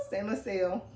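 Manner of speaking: usual speaking voice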